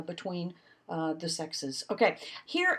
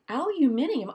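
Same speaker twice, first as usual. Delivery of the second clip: incredulous; 6 semitones higher, 4 dB louder than usual. Retold or imitated. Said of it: "I was like".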